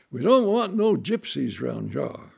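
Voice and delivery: loud rough threat from armed farmers; gruff farmers. Retold or imitated. Armed farmers warning off the strangers, rough loud threat. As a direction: bark it like a hostile farmer. Hostile farmers confront family.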